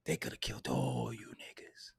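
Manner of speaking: conspiratorial whisper; whispered aside. Real, not acted. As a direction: drop to a whisper for the dark punchline. Dark aside after germ warfare.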